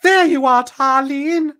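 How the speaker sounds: loud, high sorceress cry; high-pitched shout. A narrator's reading. Sorceress finds Tarlene at last, loud high-pitched shout. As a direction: shout it high as you spot them. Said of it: "shouts Mordana".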